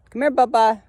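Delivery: loud high call to the dog. Real, not acted. Calling the dog over.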